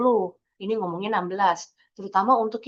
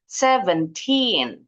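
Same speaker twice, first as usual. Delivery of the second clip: put-on "Seventeen" very slowly with a drawn-out TEEN; clear TEEN; much slower than usual. Shown as a demonstration. Teacher repeating seventeen for learners, slow clear TEEN. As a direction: stretch "Seventeen" so the teen comes through. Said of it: "ngucapinnya gitu".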